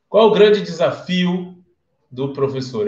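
usual speaking voice

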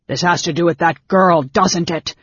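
loud, accusing shout. A narrator's reading. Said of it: "Caramon shouted".

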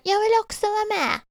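put-on very high-pitched little-kid plea; imitated eager child. Schoolgirl butts into joke-telling, high eager child voice. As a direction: pipe up high, wanting to join in.

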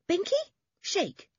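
very high, eager order; boy's dog command. A narrator's reading. Toby tells his collie to shake, eager command. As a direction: prompt your dog like a keen boy.

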